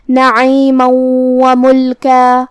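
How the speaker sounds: نعیما و ملکا very slow, the tanween merged into the و with a nasal hum; نعیما و ملکا slowly, nasal ghunna held on the joined و; idgham with ghunna. Shown as a demonstration. Teacher demonstrates idgham to students, slow and nasal. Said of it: "تنوین کو واو میں ملا کے غنہ اور تشدید کے ساتھ".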